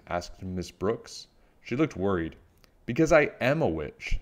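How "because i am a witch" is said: higher-pitched, proud declaration. A narrator's reading. Little Witch explains her costume to the teacher, proudly. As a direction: state it with childlike pride. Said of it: "said Little Witch proudly".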